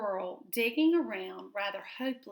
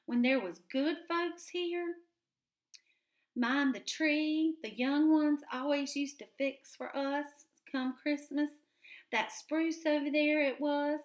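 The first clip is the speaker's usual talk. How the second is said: put-on old gray squirrel, higher, reminiscing; chatty old squirrel, wistful; higher old squirrel voice remembering Christmas; 6 semitones higher than usual.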